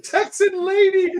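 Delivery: high-pitched mocking echo. Echoing the Texan lady guess.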